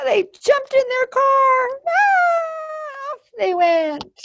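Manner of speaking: very high, loud panicked shriek; scared screaming campers. Acted. The campers scream and flee from Monster, very high.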